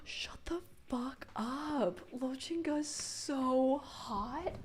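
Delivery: whispered, slow and awestruck; breathy awed whisper. Real, not acted. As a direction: hush it out in disbelief. Fangirling over character art.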